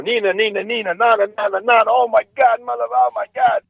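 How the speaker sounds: high, babbling comic pain; hammed-up writhing on the floor in pain; mock agony. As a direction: wail nonsense as if kicked in the groin. Acts out comedian writhing after kick.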